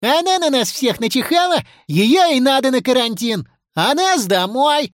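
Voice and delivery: high, eager backing-up cry; high-pitched schoolboy protest; Миша Леший. Acted. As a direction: chime in as an indignant pupil. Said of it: "поддержал товарища Миша Леший".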